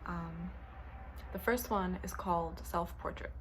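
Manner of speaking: normal talking voice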